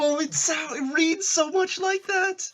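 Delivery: high-pitched wailing protest; excited protest. Real, not acted. Listener told they didn't go Sith, high excited protest. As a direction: squeal the objection in dismay.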